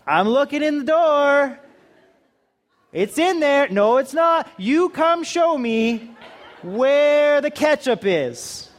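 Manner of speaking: high, slow, laughing complaint; exasperated husband. Retold or imitated. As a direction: whine it out slowly, half laughing.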